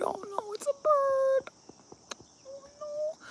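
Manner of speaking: very high, soft little voice; cooing dismay. Real, not acted. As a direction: squeak it softly in dismay. Dismayed cooing over cat's catch.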